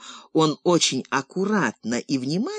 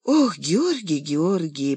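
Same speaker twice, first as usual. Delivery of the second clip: reproachful sigh; 2 semitones higher, slower than usual. A narrator's reading. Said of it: "покачала головой Липа".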